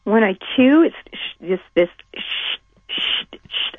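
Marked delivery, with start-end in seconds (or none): 1.1-3.7 voiceless, hushed shushing bursts; imitated whooshing of veins. Shown as a demonstration. Caller showing the healer what chewing sounds like, whooshing noise. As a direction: make soft swishing pulses with the breath. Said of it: "I can hear my veins".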